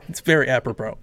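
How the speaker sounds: ordinary delivery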